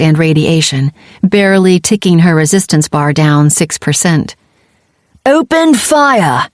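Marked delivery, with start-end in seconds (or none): 5.2-6.5 high, slow, drawn-out scream. Acted. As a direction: scream the order to fire. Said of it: "Shiloah screamed".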